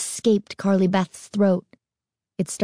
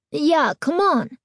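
put-on high, drawn-out boyish plea; whiny kid begging to leave; pleading; 8 semitones higher than usual. A narrator's reading. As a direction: plead with Mom, slow and high. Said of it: "his brother pleaded".

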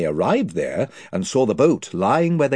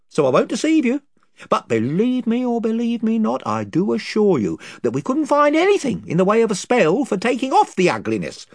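a bit higher, chatty yarn-spinning chief; rambling invisible chief; 7 semitones higher, 3 dB louder than usual. Acted. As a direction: ramble through the tale, losing the thread. Chief tells his tale.

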